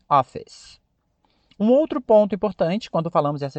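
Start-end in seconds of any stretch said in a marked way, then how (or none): 0.1-0.8 "office" as two syllables, no final vowel; crisp model "office"; English pronunciation. Shown as a demonstration. Teacher to learners, one last model "office". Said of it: "Simples assim".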